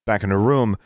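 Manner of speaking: ordinary delivery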